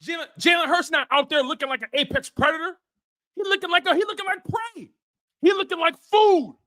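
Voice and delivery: high, shouting rant; angry rant. Real, not acted. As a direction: yell in disbelief at the quarterback. Ranting at his team's quarterback.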